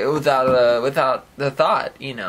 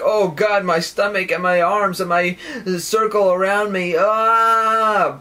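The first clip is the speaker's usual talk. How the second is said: mock anguish, higher and building to a loud "ah"; acted overwhelm; 5 semitones higher, 4 dB louder, slower than usual. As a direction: act out being swamped by sensation. Acting out overwhelming sensory effect.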